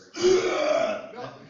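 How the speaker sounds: very loud, high fight-scene roar; game-hero battle cry; Wolverine fight roar. Shown as a demonstration. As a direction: let out a straining attack yell. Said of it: "doing this voice".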